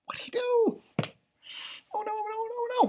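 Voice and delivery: very high, put-on alarm; mock alarm. Teacher pretends to panic at the leprechaun's mess, high. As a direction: squeak in pretend shock.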